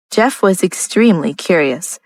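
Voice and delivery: ordinary delivery, nothing marked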